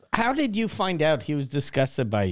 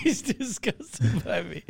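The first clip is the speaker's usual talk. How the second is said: incredulous quote through laughter; laughing mockery; 4 semitones higher, 5 dB quieter, slower than usual. Co-host mocking the dreamer's lawyer story, laughing. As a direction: tease him, laughing as you talk. Friend mockingly repeats the teller's phrase.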